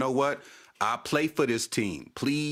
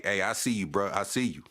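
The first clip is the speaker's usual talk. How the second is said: usual speaking voice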